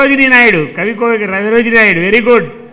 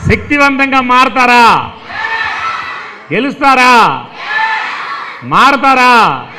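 rousing calls. Real, not acted. Rallying children with questions.

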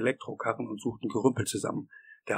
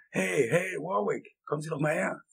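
gebrüllt; 6 semitones higher than usual. A narrator's reading. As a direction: call the foreman over from afar. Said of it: "brüllte er". (mit hoher Stimme herbeigerufen)

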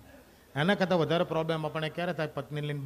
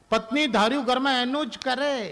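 emphatic punchline; 7 semitones higher, 4 dB louder than usual. Acted. Comic punchline about wives.

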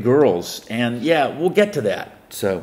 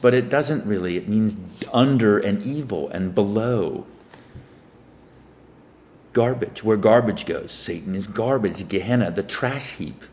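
ordinary talk, nothing marked

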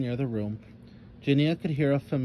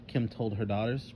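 usual speaking voice

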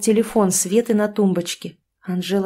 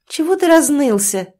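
higher, louder sharp rebuke; snapping at him; 7 semitones higher, 6 dB louder than usual. A narrator's reading. Mistress snapping at her whining lover, loud and sharp. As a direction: cut his whining short with a raised voice. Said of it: "прикрикнула на него любовница".

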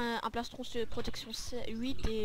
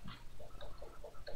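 slow breathy whisper, drawn out; whispering while hiding; 8 dB quieter, much slower than usual. Real, not acted. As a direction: hush it so they can't hear. Se cache des ennemis. (chuchoté lentement en se cachant)

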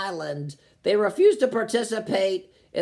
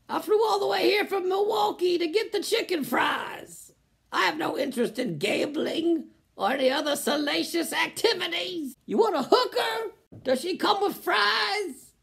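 mock prim tourist; 8 semitones higher than usual. Mocking tourist in Vegas.

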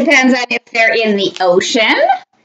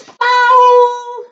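high, drawn-out character cry; calling foul; 13 semitones higher than usual. A narrator's reading. Shark protests at shooting baskets, high drawn-out call.

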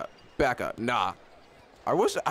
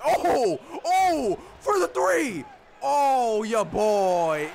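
excited shout; 9 semitones higher, much slower than usual. Real, not acted. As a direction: hype up the shot like you just won. Celebrating a made three.

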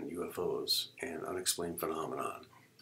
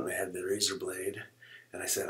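ordinary talk

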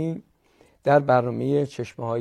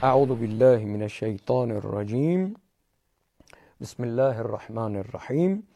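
Quran recitation; 4 semitones lower than usual. Shown as a demonstration. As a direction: recite it the tajwid way. (تلاوت قرآن با ترتیل)

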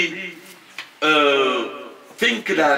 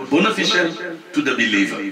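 ordinary delivery

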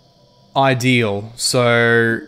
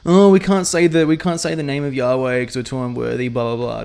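mocking objection; 3 semitones higher than usual. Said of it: "That's like".

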